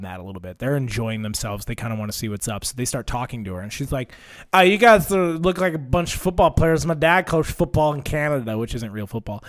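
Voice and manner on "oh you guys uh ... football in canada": lightly voiced loudmouth stranger, raised pitch; mock pushy small talk, louder and higher; imitated brash woman. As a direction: butt in loudly, playing an oddball woman. Imitates drunken-seeming woman accosting teens.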